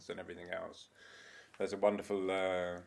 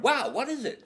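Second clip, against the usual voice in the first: high, louder amazed asking; eager question; 7 dB louder than usual. Retold or imitated. Arnold eagerly asking the patient what he sees. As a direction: ask excitedly with a laugh in it. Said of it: "And Arnold goes".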